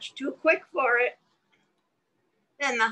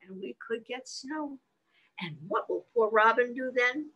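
nursery rhyme recitation; 6 semitones higher than usual. Acted.

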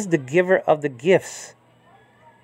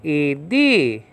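Idia with a long, raised DI; accent on second syllable. Shown as a demonstration. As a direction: lean hard on the middle of Idia. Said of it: "where you have the accent".

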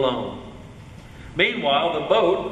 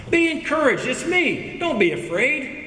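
lightly acted, higher-pitched call of encouragement; voiced Jesus, encouraging; 8 semitones higher than usual. Jesus calling to his frightened disciples, mildly raised encouragement. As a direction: lift the pitch to hearten frightened listeners.

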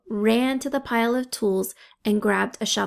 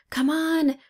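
higher, pressing call; urgent cry; 6 semitones higher than usual. A narrator's reading. Coco cries down to the others to climb, urgent. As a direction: hurry them up the ladder. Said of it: "Coco cried".

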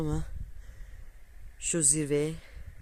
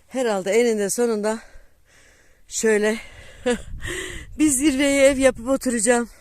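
laughing; 7 semitones higher, 6 dB louder than usual. Real, not acted. Joking about living on summit.

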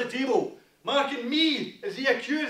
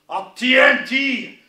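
normal talking voice

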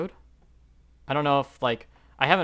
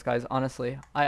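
ordinary talk

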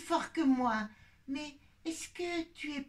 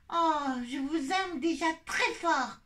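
usual speaking voice

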